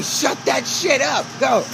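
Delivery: ordinary talk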